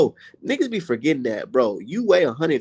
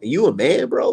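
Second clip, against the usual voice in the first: ordinary talk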